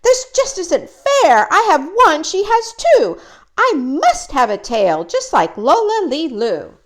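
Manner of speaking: high, loud jealous outburst; shouted. Acted. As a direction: stamp and shout like a jealous little bird. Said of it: "she shouted".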